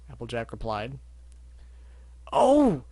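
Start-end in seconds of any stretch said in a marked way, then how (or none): 2.3-2.8 high, loud joyful gasp; joyful exclaim. Acted. Barry greeting Applejack, joyful. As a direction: burst out happily. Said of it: "Barry exclaimed joyfully".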